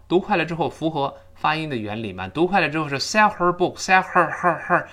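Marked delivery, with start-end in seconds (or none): 3.0-4.9 HER with its H forced out in quick reading; awkwardly sounded H. Shown as a demonstration. Teacher mocking the awkward version, H forced. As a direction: keep pushing the H in every HER. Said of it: "还要把H读出来就非常别扭".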